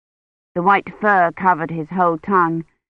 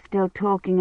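plain reading voice

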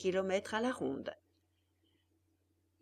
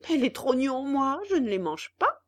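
louder, snapping refusal; venomous cry; 5 semitones higher, 8 dB louder than usual. Acted. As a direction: turn on him like a viper. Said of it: "cria le pantin ... comme une vipère".

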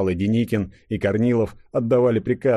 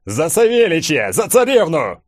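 high, loud battle cry; 9 semitones higher, 6 dB louder than usual. A narrator's reading. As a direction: hurl the war cry. (боевой клич восставших)

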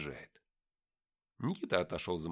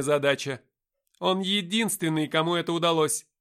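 higher, louder, assured angel speaking; angel Gabriel's voice; 8 semitones higher, 10 dB louder than usual. Acted. Angel defending Lucifer to the men, high and loud. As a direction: speak as a proud, certain angel.

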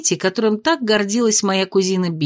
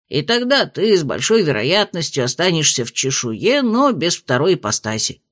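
lower, measured spirit voice; low dragon spirit voice; 6 semitones lower than usual. A narrator's reading. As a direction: speak as a wise old dragon spirit. Spirit continues reasoning.

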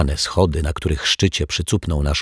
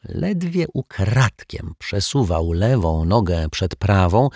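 usual speaking voice

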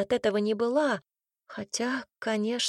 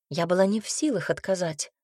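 usual speaking voice

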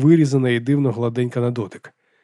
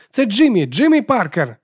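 put-on high, loud boy reporting in; boy answering radio; 9 semitones higher, 4 dB louder than usual. A narrator's reading. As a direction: call your name eagerly into the radio. Хлопчик відповідає по радіо. (високо й голосно називає себе в рацію)

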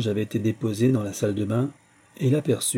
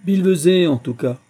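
loud, high dismissive outburst; brusque exclamation from an old professor; exclaiming; 6 semitones higher, 6 dB louder than usual. A narrator's reading. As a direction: cry it out, then settle. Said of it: "s'écria le père Calcaire".

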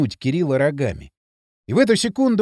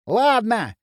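usual speaking voice

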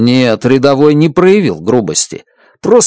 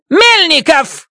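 barking; slower than usual. Acted. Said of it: "рявкнула Страт".

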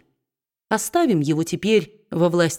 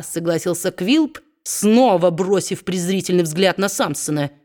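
usual speaking voice

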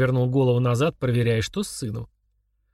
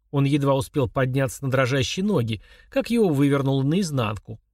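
plain reading voice

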